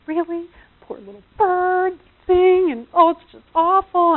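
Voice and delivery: put-on high girlish dismay; total girl. Her fussing over the poor bird, high girlish dismay. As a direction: play up pitying, girly upset. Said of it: "I was a total girl about it".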